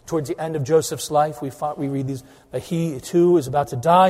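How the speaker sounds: ordinary delivery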